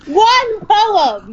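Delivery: very high, exasperated exclamation; indignant protest. Real, not acted. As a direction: insist on it in a high-pitched cry. Repeats protest at being teased.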